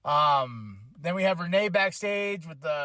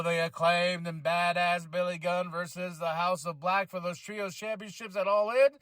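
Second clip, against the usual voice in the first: usual speaking voice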